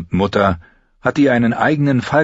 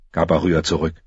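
usual speaking voice

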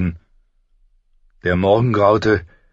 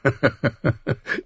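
short chuckle, higher than usual voice; laugh; 6 semitones higher, 3 dB quieter than usual. A narrator's reading. Ned amused by the king's rant, chuckling. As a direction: chuckle along with your friend. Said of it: "Ned lachte".

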